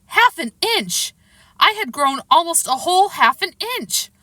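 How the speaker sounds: high, thrilled exclamation; excited. Acted. Child finds they have grown.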